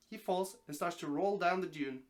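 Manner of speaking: plain reading voice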